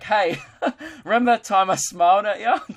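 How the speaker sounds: high, loud call broken by laughter; laughing through it. Acted. Comic podcaster acts out approaching a girl years later, high and laughing. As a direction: blurt it out brightly, cracking up.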